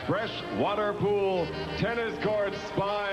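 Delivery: ordinary talk, nothing marked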